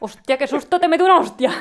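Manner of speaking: mock shout. Friend parodies the scared reaction.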